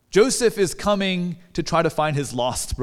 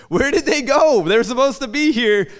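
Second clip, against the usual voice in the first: higher and louder, puzzled calling out; a lost young man wondering where everyone went; confused searching; 7 semitones higher, 4 dB louder than usual. Retold or imitated. Joseph calling out, puzzled where his brothers went. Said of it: "just looking like".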